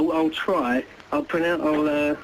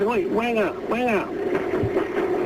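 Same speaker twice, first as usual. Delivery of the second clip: parrot call; 6 semitones higher, much slower than usual. Retold or imitated.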